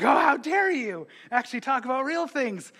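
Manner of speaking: high, hammed-up offended cry; mock outrage. Churchgoer answering the pastor, mock indignant.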